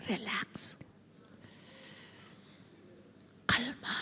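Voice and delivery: breathy whisper. Shown as a demonstration. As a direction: soothe them with a whisper. Soothing whisper. (pabulong na pagpapakalma)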